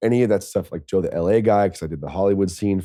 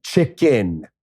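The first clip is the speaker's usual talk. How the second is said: "chicken" drawn way out, mocking himself; like an asshole; 3 semitones higher than usual. Imitating his own rude order to a waitress, obnoxiously drawn out. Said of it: "like an asshole".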